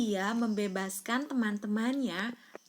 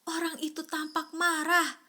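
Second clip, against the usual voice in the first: ordinary delivery, nothing marked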